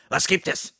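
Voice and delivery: loud, high startled shout; aufgeschreckter Ruf. A narrator's reading. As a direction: bark it as if jolted from sleep. Startled from sleep, calls out. (laut und hoch aufgeschreckt rufen)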